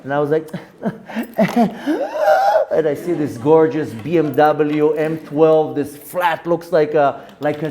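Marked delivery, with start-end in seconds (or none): 0.8-2.7 high delighted laugh bursting out; burst of laughter. Real, not acted. Rabbi laughing at a stranger offering his fancy car.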